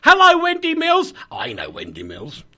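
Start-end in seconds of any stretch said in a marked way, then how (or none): 0.0-1.1 high, loud hello; loud cheery greeting. Real, not acted. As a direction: call out hello brightly. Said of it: "Could you say hi to Wendy Mills".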